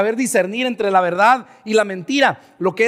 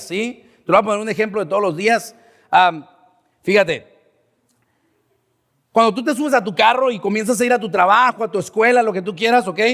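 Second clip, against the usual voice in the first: ordinary talk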